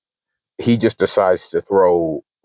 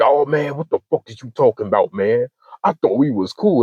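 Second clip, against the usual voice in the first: imitated friend's higher-pitched complaint; mock aggrieved; 5 semitones higher than usual. Friend insisting they were cool, mock aggrieved. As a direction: protest in a higher voice, mimicking him.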